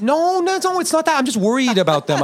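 very high-pitched mock-defensive denial; comic protest. Host denying he dislikes the audience, high comic protest. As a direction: squeak out the denial, then ease down.

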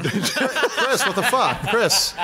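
laughing in disbelief. Real, not acted. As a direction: cry out through laughter. Laughing at injury.

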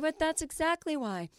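high, put-on voice of a listener; a lightly voiced know-it-all; mock listener voice. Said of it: "And you mean".

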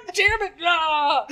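high-pitched mock rage; Alex Jones. Teasing co-host for his tirade, mock-angry impression. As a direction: imitate an angry ranter losing it. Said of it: "you're doing Alex Jones".

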